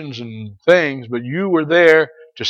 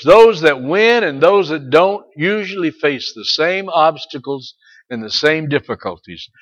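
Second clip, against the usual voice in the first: usual speaking voice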